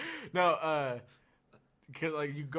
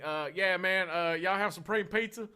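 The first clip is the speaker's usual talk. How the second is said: put-on louder, higher country boy drawl "y'all"; Oklahoma country drawl; 7 semitones higher, 7 dB louder than usual. A country boy in a fancy pizzeria orders in an Oklahoma accent. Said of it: "Like that".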